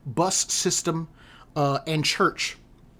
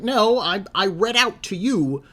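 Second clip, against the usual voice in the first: higher, louder retold objection; indignant protest; 7 semitones higher, 5 dB louder, slower than usual. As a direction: push back, exasperated, leaning on the words. Retold argument with confused customer.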